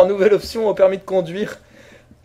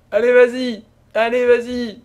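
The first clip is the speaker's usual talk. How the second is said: ordinary talk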